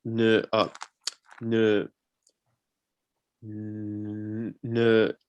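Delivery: long blocks on n, real stutter; stuck on the n. Real, not acted. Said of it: "a covert stutterer".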